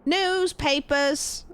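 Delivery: high, stretched British paperboy call, slightly Aussie; mock British child's street cry sliding toward Australian; British newsboy. Streamer retrying the newspaper seller, British accent gone slightly Aussie. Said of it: "I did go Aussie a little bit".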